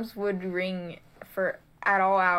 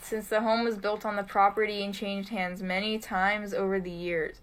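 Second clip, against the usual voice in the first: ordinary talk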